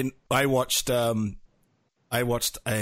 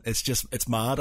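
usual speaking voice

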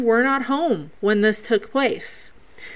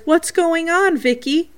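lightly voiced little boy, higher pitch; imitating Jacob; 8 semitones higher than usual. Quoting spirit boy asking Vicky, lightly imitated. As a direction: lift the voice into a boy's question. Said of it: "And then Jacob says".

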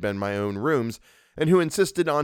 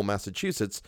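plain reading voice

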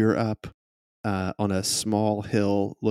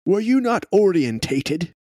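prim gentleman; 8 semitones higher, 4 dB louder than usual. Acted. NPC reproach.

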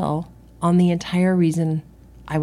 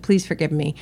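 ordinary talk, nothing marked

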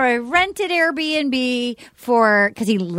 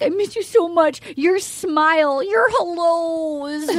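slow, hammed-up tearful mom; mock weeping; 3 semitones higher, slower than usual. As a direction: stretch it into a fake sob. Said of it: "she's weeping".

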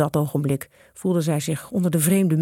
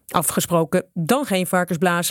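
ordinary delivery

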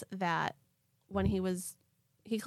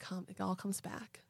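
whisper; 8 dB quieter than usual. Acted. Spooky whisper joke.

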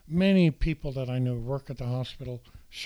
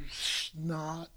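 normal talking voice

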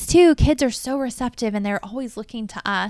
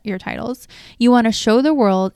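usual speaking voice

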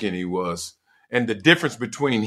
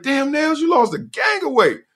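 high amazed greeting. Retold or imitated. Surprised seeing friend.

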